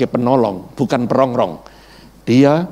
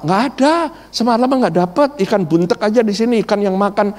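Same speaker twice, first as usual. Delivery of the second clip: higher, complaining fisherman; grumbling fisherman; 6 semitones higher than usual. Retold or imitated. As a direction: moan about a bad night's catch. Fisherman answering about bait.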